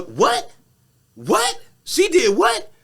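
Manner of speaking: high, loud rising what; can't believe what she heard; outraged disbelief. Retold or imitated. Meg hearing the accusation, outraged.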